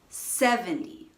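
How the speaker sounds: "seventy" stressed on "sev", weak "ty". Shown as a demonstration. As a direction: lean on the first syllable of "seventy". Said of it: "stress is on the first syllable".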